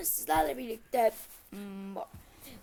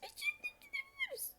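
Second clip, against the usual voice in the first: squeaky whisper; 10 dB quieter, faster than usual. Pretend. Odd squeaky voice.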